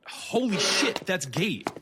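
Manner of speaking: sudden high shocked cry; shocked outburst. Acted. Detective seeing police aiming at Gate, shocked cry. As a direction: jolt up in alarm.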